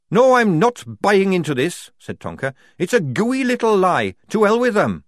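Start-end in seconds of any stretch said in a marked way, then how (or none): 0.1-1.9 high, loud objection; defiant refusal. A narrator's reading. Tonker rejecting the major's deal, defiant. 2.8-5.0 high, loud, scornful outburst; angry defiance. A narrator's reading. Tonker rejecting the lie, angry and defiant. Tonker angrily refuses the deal.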